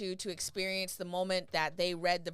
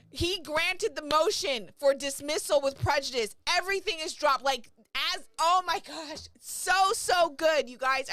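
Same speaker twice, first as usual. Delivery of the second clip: higher, loud gleeful telling; excited; 6 semitones higher, 5 dB louder than usual. Real, not acted. Streamer repeating the good news to viewers, excited. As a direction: share the thrill.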